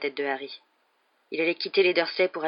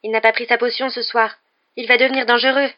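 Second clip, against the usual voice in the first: raised, louder voice of alarm; high, loud note of alarm; alarmed warning; 6 semitones higher, 7 dB louder than usual. Acted. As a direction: warn them urgently, pitch climbing. Hermione warns of werewolf danger.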